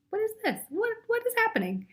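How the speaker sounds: high, fast, baffled squeal; a frantic high-pitched what-is-happening; panicked dragonfly. Retold or imitated. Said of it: "Dragonfly says".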